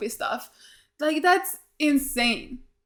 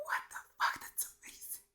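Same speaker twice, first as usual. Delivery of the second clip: breathless whispered awe; awed whisper; 16 dB quieter than usual. Real, not acted. To the screen as the scene unfolds, an awed whisper. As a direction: whisper it, amazed.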